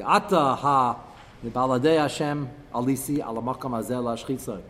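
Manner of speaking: normal talking voice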